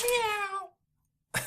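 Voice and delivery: high, drawn-out meow; cat meow. Pretend. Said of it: "It's a cat fight".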